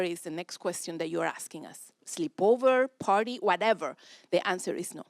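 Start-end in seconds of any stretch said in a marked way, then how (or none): none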